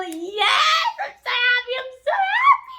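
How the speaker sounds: high, rising happy squeal; squealing happily. Real, not acted. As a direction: gush with giddy joy. Overjoyed about book.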